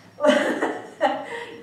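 breathy high laugh; light laugh after the joke. Real, not acted.

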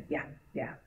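whispered yeah; just breathe the words; hushed yes. Retold or imitated. Director quietly confirms the scream, whispered. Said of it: "He's like".

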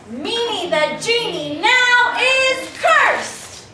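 high, loud, very slow chant; finishing a spell. Shown as a demonstration. Director showing kids the spell, slow high chant. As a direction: chant it like a curse, stretching each word. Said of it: "gonna finish the spell".

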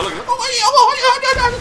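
high, laughing oh yeah; laughing gloat. Real, not acted. Gloating to viewers over a kill, laughing high.

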